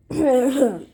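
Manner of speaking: loud throat-clearing cough. Acted. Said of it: "Jesus cleared his throat".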